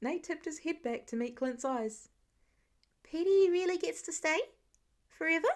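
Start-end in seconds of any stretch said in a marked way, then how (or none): 3.0-5.5 put-on hopeful child.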